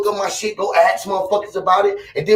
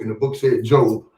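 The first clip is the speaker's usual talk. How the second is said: ordinary delivery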